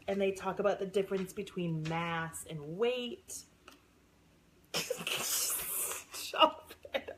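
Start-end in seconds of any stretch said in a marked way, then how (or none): 4.7-7.1 high, breathless laugh with words squeezed out; giggling, half-voiceless protest; helpless laughing. Real, not acted. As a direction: laugh through a self-scolding. Laughing at own gushing over book.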